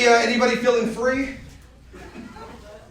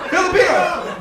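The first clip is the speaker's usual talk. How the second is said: Filipino called out; 6 semitones higher than usual. Shown as a demonstration.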